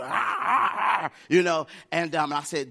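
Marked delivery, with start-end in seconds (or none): 0.0-1.1 high, loud "ah" screams; imitated crowd screaming. People screaming all around the boy, imitated high screams. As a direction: mimic screams from a haunted-house crowd. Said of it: "People were screaming".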